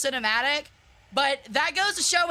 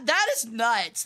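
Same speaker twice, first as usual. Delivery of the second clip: ordinary delivery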